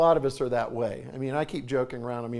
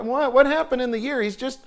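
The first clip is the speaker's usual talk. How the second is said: ordinary talk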